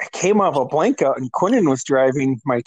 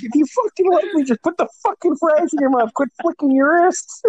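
high-pitched mock exasperation; imitating a fed-up buddy, high; retold exasperated scolding; 12 semitones higher than usual. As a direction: scold a drunk friend, voice pitched way up.

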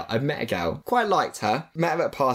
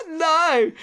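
high, louder horrified no; mortified no; 4 dB louder than usual. Retold or imitated. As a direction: blurt out a mortified no. Caught out, horrified.

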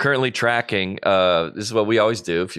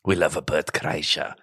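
put-on lower, quieter Russian accent, Bert Kreischer said with a Russian lilt; 5 semitones lower, 4 dB quieter than usual. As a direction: do it like Rocky's Russian fighter. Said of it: "You keep adding a".

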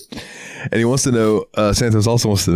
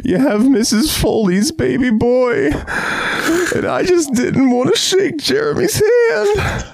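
much higher, slow whiny voice; high mocking girl voice; slower than usual. Mocking the girl who refused the handshake, high and whiny. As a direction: whine it like a prissy girl.

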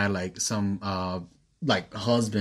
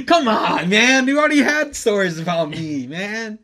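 loud, high-pitched pleading objection; playful protest; 9 semitones higher, 6 dB louder than usual. Real, not acted. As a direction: protest being told on, voice pitched way up. Guest protests at being told on.

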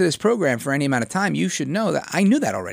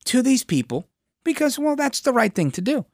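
mock lawmakers voice; 7 semitones higher than usual. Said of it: "the state of New York decides".

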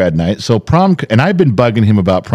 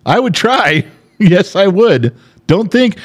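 playful insistence; 9 semitones higher, slower than usual. Real, not acted. Playful insistence after her laugh.

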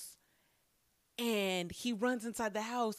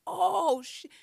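shocked oh shit; 9 semitones higher, 8 dB louder than usual. Retold or imitated. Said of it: "And I'm like".

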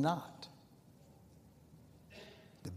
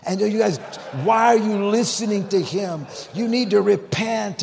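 high, loud exhortation; loud rebuke; 8 semitones higher, 8 dB louder than usual. Retold or imitated. Dying woman quoted rebuking backslidden kids, loud urgent rebuke. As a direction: call them to repent at full preaching volume.

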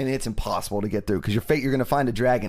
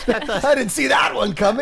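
higher, slower words with laughter running through; mock victim laughing it off; laughing wife; 8 semitones higher, 4 dB louder than usual. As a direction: joke as the shot wife, giggling. Playing the shot wife, laughing.

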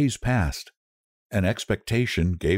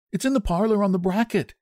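quick, higher, mildly puzzled reply; marvelling; 12 semitones higher, faster than usual. A narrator's reading. Husband puzzled by wife's sudden request, marvelling. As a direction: answer with a touch of wonder.

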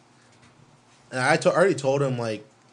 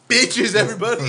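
a grown man's proud shout, raised high; hyped-up quote; 8 semitones higher, 5 dB louder than usual. Retold or imitated. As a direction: bark it out all hyped, like you own the room. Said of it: "He felt hype when he said".

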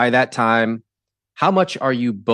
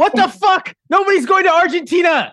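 shocked shout. Real, not acted. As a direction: yell it in total disbelief. Host reacts to Argentina trip.

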